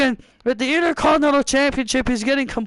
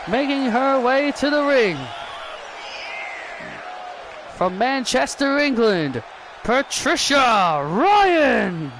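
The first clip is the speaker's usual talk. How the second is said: ring announcer. Acted. Ring announcer and entrance call.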